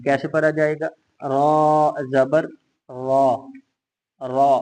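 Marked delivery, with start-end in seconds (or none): 1.2-2.4 slow, heavy "रा", thick and full; teacher spelling out a heavy "रा". Shown as a demonstration. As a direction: draw out "रा जबर" with a heavy, full-mouthed ra. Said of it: "इसको मोटा पढ़ेंगे".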